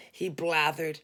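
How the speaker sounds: plain reading voice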